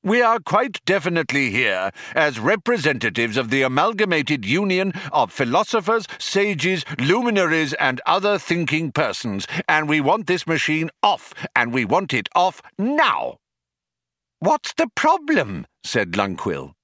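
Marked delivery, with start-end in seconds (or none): 0.0-13.4 higher-pitched, insistent official protest; indignant union spokesman. Acted. Philosopher delivers union demands to programmers, indignant spokesman. 14.4-15.7 high-pitched exasperated question; exasperated challenge. Acted. Programmer asks the intruders their complaint, exasperated challenge.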